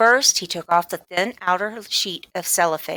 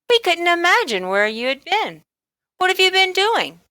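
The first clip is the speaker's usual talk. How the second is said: a mother pitched up high and bright with relief; mother's voice; 12 semitones higher than usual. Acted. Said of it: "said Missus Midas".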